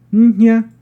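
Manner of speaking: Ña said high and loud, on a high tone; Mazatec word Ña. Shown as a demonstration. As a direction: hit Ña on a clear high tone. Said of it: "la N con tono bajo, con tono alto".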